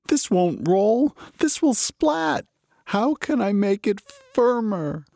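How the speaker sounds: high, slow, worried fool; Muddle voice. A narrator's reading. Muddle alone, fretting about the dough. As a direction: fret over the floppy rings.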